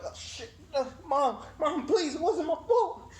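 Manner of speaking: high, loud laughing plea; pleading with mom. Retold or imitated. Son getting beaten, pleading high and laughing with mom. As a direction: beg mom to stop, laughing. Begging mom during beating.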